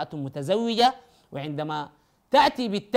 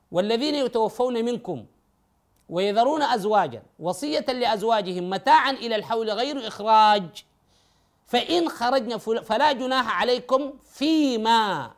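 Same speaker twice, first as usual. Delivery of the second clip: Quran recitation; 4 semitones higher, 4 dB louder than usual. Shown as a demonstration. Teacher recites the parallel verse.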